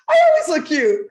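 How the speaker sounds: high, loud, self-assured kid imitation with a laugh; imitated confident daughter. Mom quoting her confident eleven-year-old, loud and self-assured. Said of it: "I'm not in a jerky way".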